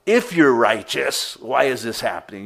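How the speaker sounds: resentful, accusing question to God; a touch of bitter grievance; negative complaint. Retold or imitated. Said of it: "in a negative way saying".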